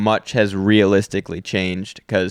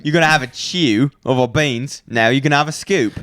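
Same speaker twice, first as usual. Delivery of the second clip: higher-pitched cartoon Brit; mock British accent; 4 semitones higher than usual. Brit offering a chew of beans, mock British accent. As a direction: put on a broad British accent. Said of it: "I like the British act".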